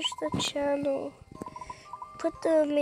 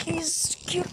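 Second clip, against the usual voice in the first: whisper; 2 semitones higher, 4 dB quieter than usual. Pretend. Silly aside.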